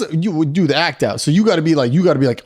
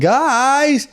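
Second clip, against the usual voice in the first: put-on much higher, drawn-out kid's call; high-pitched kid; 12 semitones higher than usual. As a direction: whine it high like a kid left behind. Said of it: "fatter but high-pitched voice kid".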